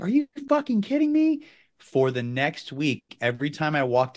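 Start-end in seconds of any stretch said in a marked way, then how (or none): 0.0-1.5 high, loud, exasperated shout at the dog; furious at the dog for eating the remote; loud angry yell. Retold or imitated. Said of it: "And me being loud".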